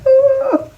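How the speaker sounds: high-pitched creepy ooh; high wavering shudder of a noise; creeped-out ooh. Acted. Woman creeped out by her own dream, high ooh.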